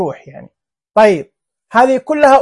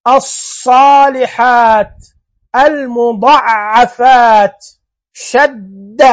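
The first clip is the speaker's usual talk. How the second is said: slow drawn-out demo; much slower than usual. Shown as a demonstration. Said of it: "زي المضعفات".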